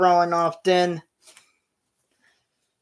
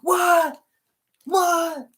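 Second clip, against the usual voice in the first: much higher shocked cries; outraged disbelief at being eliminated; freaking out; 11 semitones higher, 4 dB louder than usual. Retold or imitated. Seth just eliminated, shocked outraged cry. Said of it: "Seth is freaking out".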